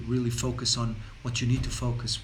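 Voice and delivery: normal talking voice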